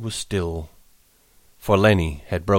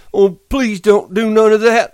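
frightened begging; 13 semitones higher, 8 dB louder than usual. A narrator's reading. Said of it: "he begged".

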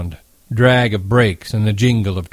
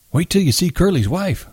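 put-on lightly raised old man's voice; gossipy old bunkhand, pitched up; a hint of an eager old man; old swamper; 8 semitones higher than usual. A narrator's reading.

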